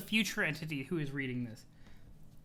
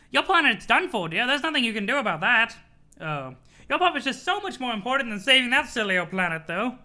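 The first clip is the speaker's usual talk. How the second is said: lightly voiced grand sprite, a bit louder; 3 semitones higher, 5 dB louder than usual. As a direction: play the mysterious sprite calling John dear. Sprite voice continues.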